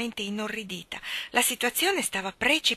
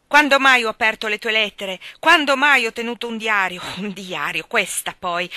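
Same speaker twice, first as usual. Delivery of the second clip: vocal rage; 3 semitones higher, 9 dB louder than usual. A narrator's reading. Cyrus raging at his family, loud furious rant. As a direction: hammer each question at them. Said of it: "la collera del padre era vocale".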